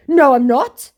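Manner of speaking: high, loud put-on scammer; mock scammer denial.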